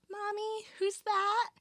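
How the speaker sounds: high-pitched imitation of a little daughter; daughter's voice. Friend retelling the horror scene to co-host, high child voice. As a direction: put on a child's high voice calling for mommy. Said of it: "her daughter was like".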